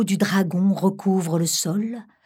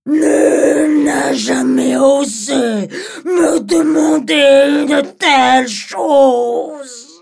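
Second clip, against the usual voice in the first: loud, very slow furious dragon; 8 semitones higher, 7 dB louder, much slower than usual. Acted. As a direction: bellow it out in fury. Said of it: "d'un air furieux".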